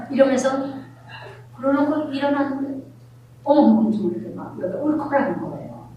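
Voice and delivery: normal talking voice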